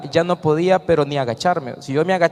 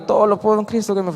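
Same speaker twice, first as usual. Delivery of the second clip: mumbled through tears, a bit higher and fast; tearful rushed recital; 6 semitones higher, faster than usual. Retold or imitated. Retelling his tearful first try at the verse, hurried and higher. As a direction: rush the verse while still crying. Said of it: "Y yo iba llorando".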